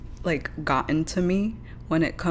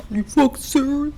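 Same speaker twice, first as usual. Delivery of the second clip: higher, louder mock of the ranter; mock imitation; 8 semitones higher, 5 dB louder than usual. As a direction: lightly mimic his indignant question. Mimics the ranter in the clip.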